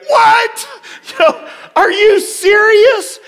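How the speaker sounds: very high, loud incredulous shriek; yelling in disbelief. Retold or imitated. Pastor reacts to nurse's news, yelling in disbelief. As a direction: re-enact a stunned yell, voice shooting up. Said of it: "I was yelling".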